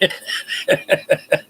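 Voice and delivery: breathy relieved chuckle; relieved laughter. Real, not acted. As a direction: let out a relieved little laugh. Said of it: "That scared the hell out of me".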